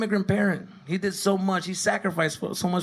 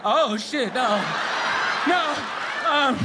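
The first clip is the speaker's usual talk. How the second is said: panicked son; 10 semitones higher than usual. Retold or imitated. Son apologizing to refs.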